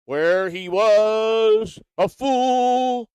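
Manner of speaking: high, loud, very slow sneer; mocking drawl. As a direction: stretch it out to mock him. Mocking drawn-out jab.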